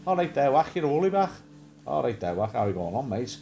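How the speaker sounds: imitating a Woolly Back greeting; Woolly Back accent. Imitating what a friend called Woolly Backs, in their accent. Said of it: "a friend of ours was talking about Woolly Backs".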